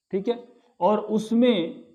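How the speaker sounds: ordinary talk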